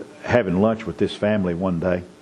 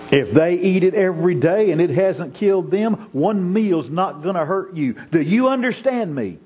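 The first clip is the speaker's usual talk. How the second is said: higher, building scolding from a mother; stern mother; 6 semitones higher than usual. Retold or imitated. Mother warning her young son, stern and rising. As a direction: lay down the law like a strict mom.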